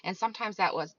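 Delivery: normal talking voice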